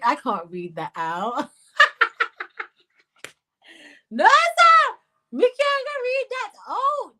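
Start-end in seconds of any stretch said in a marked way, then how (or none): 1.8-2.6 high, loud cackle; helpless laughter. Real, not acted. Host reads a rude comment silently, high laughter. 4.1-7.1 very high, loud squealed refusal; scandalised shriek. Real, not acted. Host refuses to read a rude comment, scandalised shriek.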